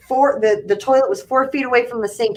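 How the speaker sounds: ordinary talk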